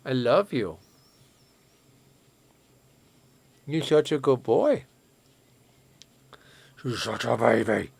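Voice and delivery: cooing pet voice in an odd timbre. Real, not acted. Owner cooing to her cat in a silly baby voice.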